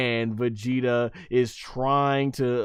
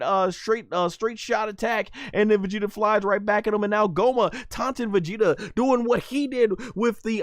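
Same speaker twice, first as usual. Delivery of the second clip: high, rushing fight commentary; pitched-up commentator energy; excited play-by-play; 8 semitones higher than usual. Real, not acted. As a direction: call the action as it happens.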